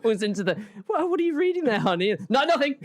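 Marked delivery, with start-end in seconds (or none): 0.9-2.2 high-pitched mock partner's question; sweetly nosy, pitched well up; curious fiancé. Partner walking in on the secret reader, curious. 2.3-2.7 high, hasty mock denial; flustered cover-up, louder and pitched up; caught out. Caught reading by a partner, mock flustered. Acts caught reader hiding the book.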